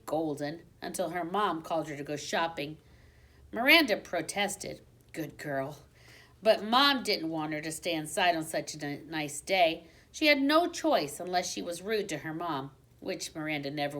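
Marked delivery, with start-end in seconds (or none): none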